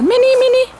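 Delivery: high, loud sing-song call to a cat. Real, not acted. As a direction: call her name up high across the garden. Calling the cat in garden.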